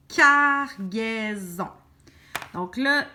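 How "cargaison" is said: CARGAISON slowly split as car, gai, son, loud first syllable. Shown as a demonstration. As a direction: stretch each syllable of CARGAISON for the learner. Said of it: "Couper ton mot en par syllabe".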